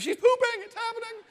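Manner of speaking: shrill high panic, way up in pitch; panicked excitement. Retold or imitated. Dad texting his wife, excited panic at the news. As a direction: squeal it out like big news finally arrived.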